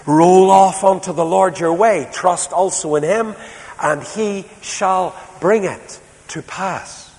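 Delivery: stirring preacher reading of Roll off unto the Lord; emphatic rereading. Shown as a demonstration. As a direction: proclaim the verse with force. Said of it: "Can I read that again?".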